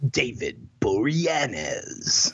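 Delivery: slow, drawn-out promo announcer. Shown as a demonstration. Finishing the show title for his co-host, in a slow announcer voice. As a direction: stretch the name out like a trailer voiceover. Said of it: "you gotta do it with an announcer voice".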